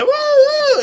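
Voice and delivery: high loud wailing siren noise; siren imitation. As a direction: wail like a fire siren.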